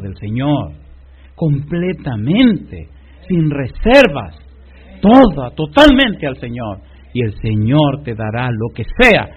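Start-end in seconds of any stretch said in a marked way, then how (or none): 1.3-6.8 slow, each word punched, peaking loud on toda; emphatic. Acted. Preacher pressing the congregation to surrender totally, slow and loud. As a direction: lean hard on every word.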